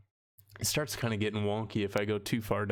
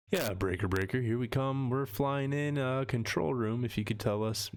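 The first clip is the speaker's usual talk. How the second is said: higher-pitched pretend pilot radio call; mock pilot; 3 semitones higher than usual. Streamer pretending to radio the control room, mock pilot voice. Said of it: "talk like a pilot".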